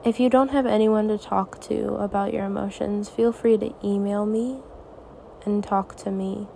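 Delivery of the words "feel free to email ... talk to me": ordinary talk, nothing marked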